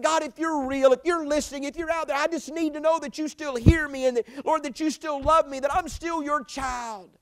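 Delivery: high and loud, begging to be heard; desperate plea. Retold or imitated. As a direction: plead with God like a lost child. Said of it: "And I said".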